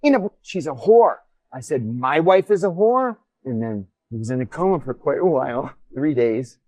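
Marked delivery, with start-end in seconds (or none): 0.0-1.2 imitating a worked-up driver, high and loud; retold agitated outburst, pitched up; all excited. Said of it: "he gets all excited". 1.8-3.2 retold indignant question, high and loud; raised, louder echo of an insult; incredulous challenge. Repeats insult back, incredulous.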